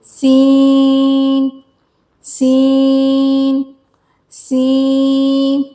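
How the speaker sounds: सीन slow with a whistling s; whistling seen. Shown as a demonstration. Teacher modelling a whistling सीन slowly. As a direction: stretch the hiss of सीन slowly. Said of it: "सीन में सीटी की सी आवाज़ आनी चाहिए".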